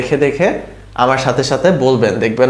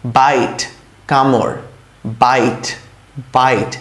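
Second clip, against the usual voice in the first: ordinary talk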